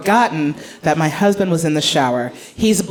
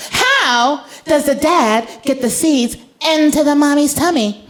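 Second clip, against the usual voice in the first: put-on higher, slow innocent kid question; little girl voice; 6 semitones higher than usual. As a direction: ask it like a curious toddler. Daughter asking.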